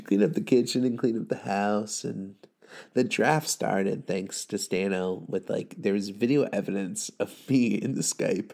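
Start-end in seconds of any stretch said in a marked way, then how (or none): none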